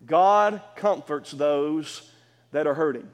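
each word of "God comforts hurting people" laid down slowly; slow, weighty statement. Shown as a demonstration. Pastor states his point to the congregation, slow and weighty. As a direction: spell the statement out slowly for the church. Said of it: "Let's say this".

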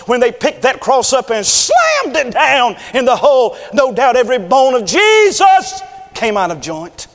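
loud, rising, fiery sermon read; preaching at full force. Acted. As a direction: thunder the scripture over the congregation.

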